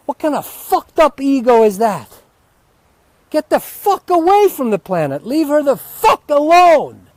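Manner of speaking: loud furious rant, pitched high; yelling. Real, not acted. Ranting outdoors until his wife fears the neighbors, yelling. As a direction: bellow it at the whole world. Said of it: "If you start yelling like that".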